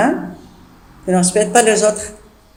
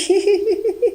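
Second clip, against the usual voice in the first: a quick high-pitched giggle; teacher demonstrating a maid's giggle; 10 semitones higher, 6 dB quieter than usual. Shown as a demonstration. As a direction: show how Nicole laughs. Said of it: "Là, Nicole en train de rire".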